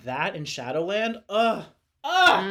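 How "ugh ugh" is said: loud, higher-pitched rapturous "ugh"; swooning groan. Real, not acted. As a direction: groan with delight at a beloved song. Swooning over favourite songs.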